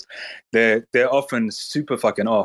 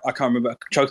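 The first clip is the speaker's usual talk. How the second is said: normal talking voice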